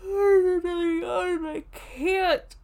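near tears, voice breaking; choked up. Real, not acted. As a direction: let it crack with emotion. Overwhelmed with emotion.

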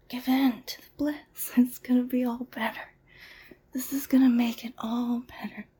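ordinary talk

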